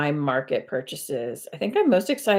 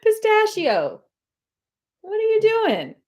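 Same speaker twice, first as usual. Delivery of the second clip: calling the cat; 4 dB louder than usual. Real, not acted.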